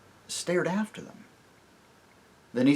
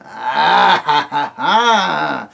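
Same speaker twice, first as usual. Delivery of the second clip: loud scornful dwarf laugh; mocking laugh; 3 semitones higher, 10 dB louder, much slower than usual. Griffle laughs at Jill, mocking. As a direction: laugh them off. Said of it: "said Griffle with a broad smile".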